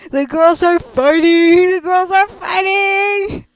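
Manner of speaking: slow, high and loud gleeful cry; thrilled call-out at the drama; excited shout. Real, not acted. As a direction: cheer it like a playground announcement. Excited about game quarrel.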